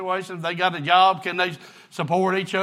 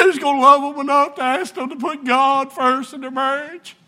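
higher, pleading pulpit voice; preaching; 7 semitones higher, 4 dB louder than usual. Acted. Preacher asking his church who will love them, pleading. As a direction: press the question on them, rising.

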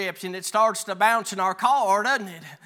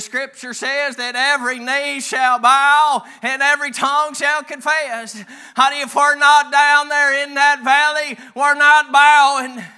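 high preaching voice building louder; 5 semitones higher, 8 dB louder than usual. Real, not acted. Preacher quoting scripture on bowing, preaching loudly. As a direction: proclaim it with mounting force.

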